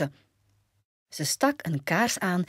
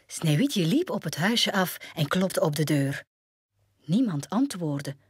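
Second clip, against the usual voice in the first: ordinary delivery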